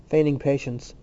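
ordinary delivery

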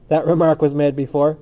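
plain reading voice

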